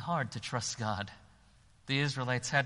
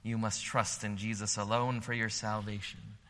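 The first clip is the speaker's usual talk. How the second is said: ordinary delivery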